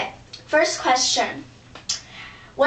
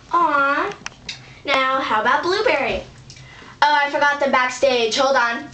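normal talking voice

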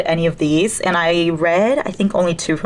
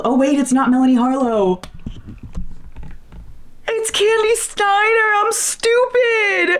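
mock despair. Real, not acted. Realizing wrong book, mock despair.

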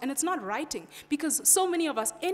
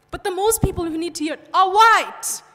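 much louder and higher, driving the point home; emphatic; 6 semitones higher, 12 dB louder than usual. Real, not acted. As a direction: say it loud so the back row hears. Impassioned plea to the audience.

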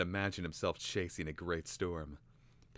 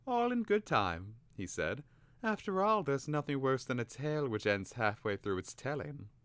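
put-on much higher, playful boy voice; teasing boy; 7 semitones higher than usual. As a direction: tease the bird. Twig voiced in story.

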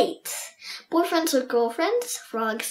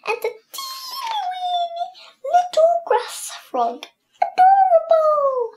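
1 cute cooing; 14 semitones higher than usual. Real, not acted. Cooing over tiny frog.

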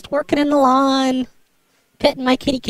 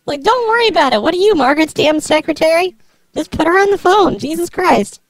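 higher-pitched, irritated snapping; exasperated; 4 semitones higher than usual. Acted. Prank caller demands the neighbor be put on, exasperated. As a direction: push back impatiently, pitch raised. Acted quarrel on prank call.

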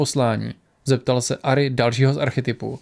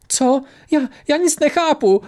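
put-on high, shaking girl's voice full of fear; a terrified girl trembles before the gods; trembling with fear; 12 semitones higher than usual. A narrator's reading. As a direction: stammer it out, much higher, scared stiff. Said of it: "klepala se strachy dívka".

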